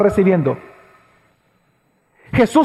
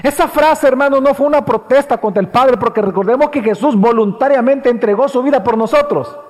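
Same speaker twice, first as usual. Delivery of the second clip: ordinary talk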